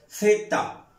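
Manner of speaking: teacher hissing the Z sound for the class; hissed Z. Shown as a demonstration. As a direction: hiss the Z out hard. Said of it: "¿Qué órganos se juntan".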